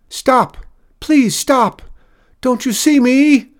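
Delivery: high, loud, desperate screaming; frantic shout at an oncoming train. A narrator's reading. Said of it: "he screamed".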